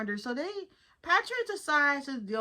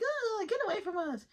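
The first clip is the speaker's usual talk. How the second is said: high, recoiling brush-off; mock disgusted; 11 semitones higher than usual. People in the bathroom shooing SpongeBob, high. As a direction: push them away, pitched up. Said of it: "He's like".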